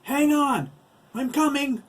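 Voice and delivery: very high, loud rescue call; frantic high-pitched holler; urgent shout. A narrator's reading. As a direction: yell to someone in danger. Max shouting while running to help.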